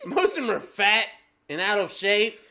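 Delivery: ordinary talk, nothing marked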